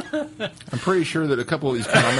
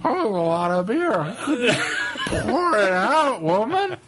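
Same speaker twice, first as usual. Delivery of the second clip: high, slow, laughing stumble-home voice; hammed-up tipsy back talk; mock drunken excuse; 6 semitones higher, 3 dB louder than usual. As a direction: protest in a raised, dragged-out voice while laughing. Acts out drunken excuse to mom.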